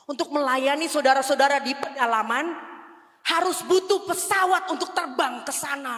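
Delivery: loud, high insistent plea; urgent plea. Retold or imitated. Pleading to husband, retold.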